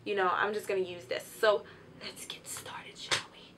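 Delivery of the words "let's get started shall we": playful whisper; hushed, teasing whisper to the viewers. Real, not acted. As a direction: whisper it like a secret start. Playful start of demo.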